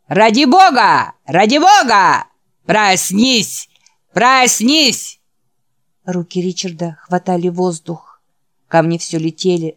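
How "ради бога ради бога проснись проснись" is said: loud, high drawn-out chant; jeering chorus. Acted. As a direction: howl it mockingly like a mob. Mob outside mocks with chant. (громкий издевательский вой хором)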